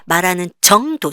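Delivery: ordinary delivery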